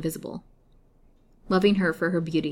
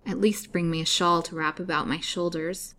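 plain reading voice

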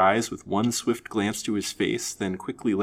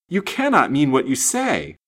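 higher, louder disbelief; alarmed surprise; 6 semitones higher, 6 dB louder than usual. A narrator's reading. Young man refusing to believe her love confession, alarmed surprise. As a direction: protest in dismay. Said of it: "more surprised and actually alarmed".

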